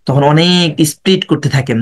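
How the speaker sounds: usual speaking voice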